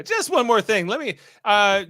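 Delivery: lightly acted detective afterthought; a hint of a fumbling TV detective; Columbo. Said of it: "like, a Columbo move".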